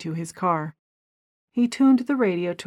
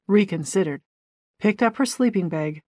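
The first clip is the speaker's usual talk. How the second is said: usual speaking voice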